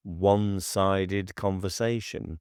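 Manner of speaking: ordinary delivery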